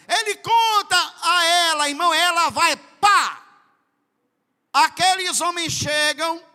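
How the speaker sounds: loud, high preaching burst; preaching shout. Real, not acted. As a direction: hammer it out to the church. (pregando aos gritos, bem alto)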